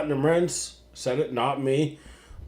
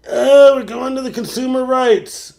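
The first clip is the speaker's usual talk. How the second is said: mocking caller; 9 semitones higher, 7 dB louder than usual.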